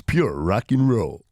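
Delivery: deep, slow American radio voice; American accent. Pretend. Host brands the show on air in a deep American accent. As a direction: drop low and drawl it like an American DJ. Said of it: "Is that your American accent?".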